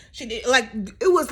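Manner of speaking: ordinary talk, nothing marked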